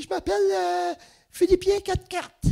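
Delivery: a small woman in the elevator introducing herself in a very high voice; tiny woman's voice. Retold or imitated. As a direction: chirp the name like a tiny lady. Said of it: "elle a une petite voix".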